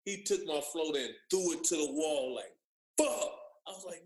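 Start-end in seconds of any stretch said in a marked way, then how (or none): 3.0-3.3 high, punchy exclamation; mock impact shout. Player mimics his floater getting thrown to the wall, punchy exclamation. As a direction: blurt it like the slam of a blocked shot. Imitated slam of blocked shot.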